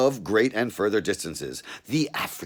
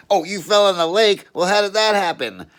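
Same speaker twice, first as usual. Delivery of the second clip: high, loud put-on cop voice; a suspicious cop barking a question; gruff cop; 11 semitones higher, 8 dB louder than usual. Cop questioning a wet man in bushes, loud high voice.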